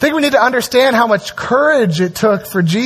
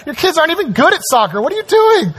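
mock scolding; 7 semitones higher, faster than usual. Mock scolding about soccer.

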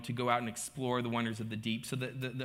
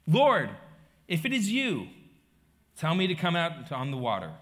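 loud, raised call that eases toward the end; 3 semitones higher, 6 dB louder than usual. Retold or imitated. Peter calls to Jesus from the boat, calling out. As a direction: call out boldly, word group by word group.

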